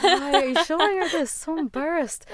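high, loud mock panic; retold freak-out. Artist freaking out as boss is shown the videos, mock panic. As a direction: act out a mortified squeal of protest. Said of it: "I would be freaking out".

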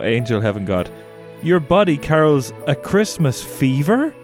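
ordinary talk

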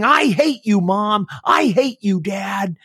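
high, strained tantrum shout at the parents; childish angry yelling. Retold or imitated. Eight-year-old shaking the stairs at mom and dad, high tantrum yell.

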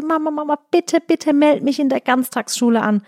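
a hint of a daughter nagging mama; pleading little girl.

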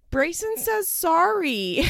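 put-on high, slow little-kid voice, saying sorry; imitating toddler. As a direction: say it like a tiny toddler apologizing. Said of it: "At one point he says".

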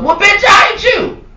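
high-pitched yelled comeback; acted indignant retort. Employee answering a manager's bragging, outraged. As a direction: explode at the boss in mock outrage.

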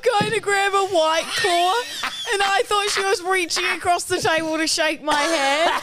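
real laughter breaking through an embarrassing story; laughing. Real, not acted. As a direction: blurt it out while cracking up. Laughing retelling embarrassing moment.

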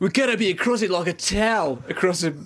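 high, exaggerated Aussie accent; Australian accent. Co-host showing off the Aussie accent, hammed-up Australian. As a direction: put on a hammed-up Australian twang. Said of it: "I can't do the accent".

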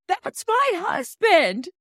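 high, drawn-out indignant cry; mock outburst. Mimicking a reality star's outburst to a co-host, mock outraged. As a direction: stretch it out as a high, outraged imitation.

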